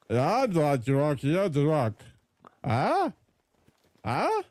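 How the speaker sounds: slow slurred dumb lug voice; belligerent drunk. Shown as a demonstration. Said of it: "doing a voice like this".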